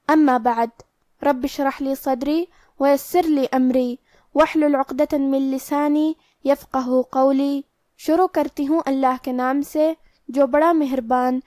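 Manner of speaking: usual speaking voice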